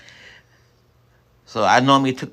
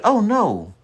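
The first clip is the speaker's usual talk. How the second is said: worried wife; 13 semitones higher than usual. Retold or imitated. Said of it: "She said".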